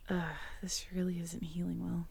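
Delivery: soft unvoiced whisper, worried. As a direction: breathe it out quietly over the wound. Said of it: "unvoiced whisper".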